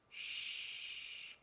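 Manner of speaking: long soft whispered shush; very quiet, drawn-out shh; hushing shh. A narrator's reading. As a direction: hush for someone falling asleep. Goldilocks falling asleep.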